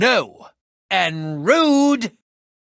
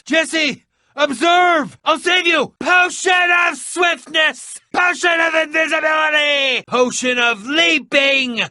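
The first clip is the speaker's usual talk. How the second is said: heroic shouting; 9 semitones higher than usual. Acted. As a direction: announce each potion like a showman. Hero shouting potion names mid-rescue.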